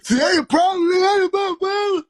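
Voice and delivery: very high, loud imitated scolding; mock outburst. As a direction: yell it in a hammed-up high voice. Voices the angry other side of argument.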